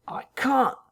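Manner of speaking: high, urgent whisper; whispered protest. Acted. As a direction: hiss it out in protest. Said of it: "his voice rising".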